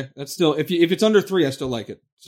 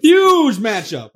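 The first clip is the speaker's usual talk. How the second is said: hype announcer; 4 semitones higher, slower than usual. Acted. Hyping the game like announcer.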